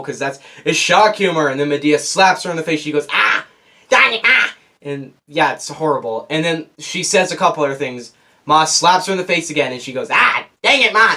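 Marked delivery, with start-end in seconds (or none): 3.1-4.5 annoying grandma yelp. Retold or imitated. Mocking the slapped grandma with a high yelp. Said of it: "saying in a really annoying voice". 10.1-11.1 annoying grandma yelp. Retold or imitated. Mocking the slapped grandma again, high yelp. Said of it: "saying in a really annoying voice".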